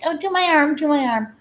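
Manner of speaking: mock pleading. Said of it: "was like".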